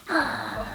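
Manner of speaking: high, imitated little dog snarl; dog growl. Teacher imitates the bitten dog's growl, a high snarl. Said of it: "the dog was going".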